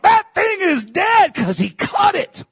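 fiery preaching shout. Real, not acted. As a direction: shout it out to the congregation. Preacher bursts out at the start.

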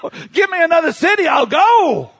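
high, loud, rushed shout; eager hollered prayer; retold urgent plea. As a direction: call out to God, rushing the words. Retells his urgent plea to God.

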